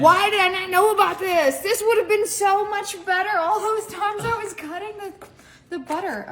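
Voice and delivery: high, loud, astonished protest; loud, high-pitched astonished lament; amazed outcry. Real, not acted. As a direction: cry out in disbelief, high and loud. Regrets not knowing about slicer.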